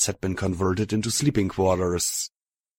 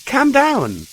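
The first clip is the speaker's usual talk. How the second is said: raised calming call; 7 dB louder than usual. A narrator's reading. Soothing armed ponies, rising.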